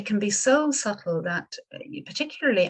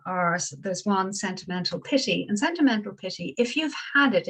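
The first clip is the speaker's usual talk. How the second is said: normal talking voice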